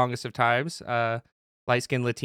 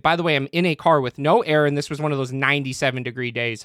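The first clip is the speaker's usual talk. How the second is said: usual speaking voice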